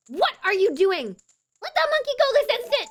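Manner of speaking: high, loud imperious shouting; outraged princess. Acted. Princess orders her father to free a monkey, outraged shout. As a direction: order it at full, high-pitched volume.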